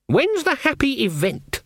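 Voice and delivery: put-on high-pitched icy old witch. A narrator's reading. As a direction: ask it with cool disdain, pitched high.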